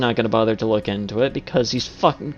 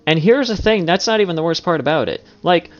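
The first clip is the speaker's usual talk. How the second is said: heated outraged rant; 5 semitones higher, 4 dB louder than usual. Real, not acted. Commentator's outraged escalating rant.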